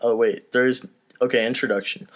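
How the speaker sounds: ordinary talk, nothing marked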